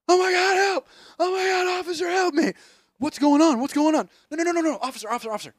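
high frantic pleading for help; mock hysterical cries for help; panicked victim. Acting out victim pleading with cops.

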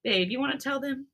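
plain reading voice

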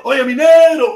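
loud call across the room, "Oye, mi negro" belted out; hearty shout. Retold or imitated. Host greets a viewer with a loud street-corner call. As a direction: holler it like greeting a buddy down the street.

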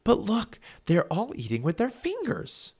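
ordinary delivery, nothing marked

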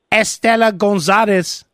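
put-on Estela Gonzalez said slowly with an overdone Spanish accent; Spanish accent on the name. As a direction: switch into a thick Spanish accent for the name only. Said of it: "why do you throw in those accents".

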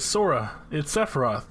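ordinary talk

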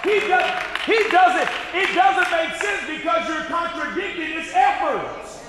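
loud, high preacher's cry; preaching shout. Acted. Preacher shouting at the congregation, fired up.

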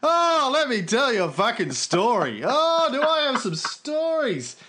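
high, grandly stretched party bragging; hammed-up eager raconteur; self-mocking boaster. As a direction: announce it like a show-off holding court. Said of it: "a bit like me going".

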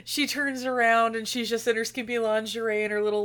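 ordinary talk, nothing marked

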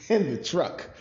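"truck" hit clearly as a correction; stress on truck. Real, not acted. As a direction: raise and punch "in the truck". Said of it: "Not the trunk".

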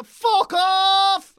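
loud, high fed-up yell; exasperated shout. Real, not acted. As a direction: bark it out, totally done. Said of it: "I'm done".

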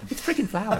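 very high pitched pleading; frantic high repetition; desperate insisting. Retold or imitated. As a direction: beg them to believe it. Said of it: "we kept saying".

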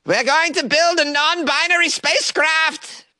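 high, hammed-up triumphant declaration; mock activist cheer. Host mocking the group's spacecraft plans, hammed-up rally cry.

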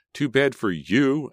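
high, slow, furious scream. A narrator's reading. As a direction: scream it back at him. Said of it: "Emma screamed".

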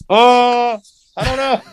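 very high, hammed-up cluelessness; mock panicked dunno; feigned ignorance. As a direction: play innocent in a squeaky-high voice. Retold feigned ignorance when cornered.

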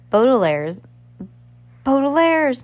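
high, drawn-out shout after someone; distant calling. A narrator's reading. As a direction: call out a name across a beach. Poe's distant call after the children.

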